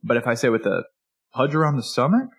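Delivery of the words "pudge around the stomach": "pudge around the stomach" echoed as a question, rising on "stomach"; questioning lilt ending on "stomach"; mirroring question. Shown as a demonstration. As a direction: repeat "pudge around the stomach" to invite more. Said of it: "that's a downward inflection".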